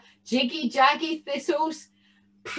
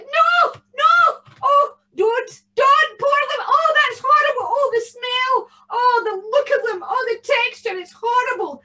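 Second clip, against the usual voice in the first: put-on very high, loud wailing old woman; an old lady pretending to be horrified; mock-distressed old lady; 13 semitones higher, 7 dB louder than usual. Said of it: "the old lady said".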